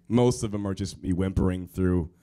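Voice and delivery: normal talking voice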